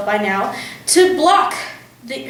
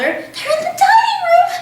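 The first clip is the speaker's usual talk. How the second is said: hissed; 3 dB louder than usual. A narrator's reading. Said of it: "hissed the mother".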